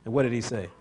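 ordinary talk

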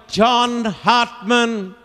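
high, very slow call; crying out. Acted. Soldier passing the cry across the field, crying out. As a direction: stretch the name into a far-carrying cry. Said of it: "he started to cry".

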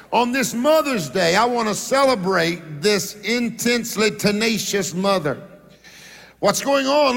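ordinary talk, nothing marked

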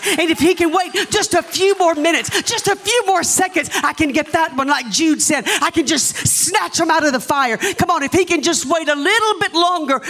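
high, pleading preacher; impassioned preaching. Real, not acted. Preaches to congregation.

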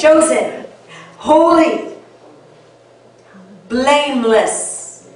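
spaced out, with long pauses between words. Shown as a demonstration. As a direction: say each word slowly and leave room after it. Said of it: "I'll say it a little slower".

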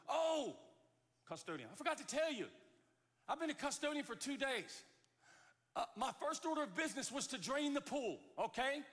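high, loud parting announcement; custodian calling back. Acted. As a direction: holler the punchline back across the pool. Custodian shouts his parting news.

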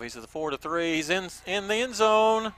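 building to a huge roaring shout; touchdown call. Real, not acted. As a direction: roar the touchdown call to the crowd. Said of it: "the stadium announcer, he's doing it with me".

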